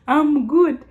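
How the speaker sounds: high mock American accent; imitated American accent; put-on local accent. Shown as a demonstration. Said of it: "You know that accent".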